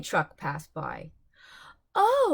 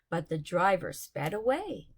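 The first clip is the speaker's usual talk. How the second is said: ordinary delivery, nothing marked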